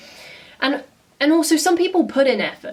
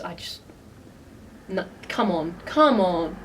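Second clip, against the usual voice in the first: normal talking voice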